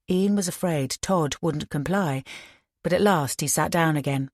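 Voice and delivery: plain reading voice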